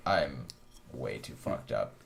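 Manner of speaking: low, slow, dragged-out stoned mumble; mock stoned voice. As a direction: sink the voice down low and drag it like you're wrecked. Stoned speaker admits being wrecked.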